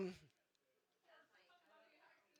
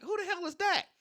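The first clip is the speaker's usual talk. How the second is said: startled question; 8 semitones higher than usual. Retold or imitated. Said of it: "It was like".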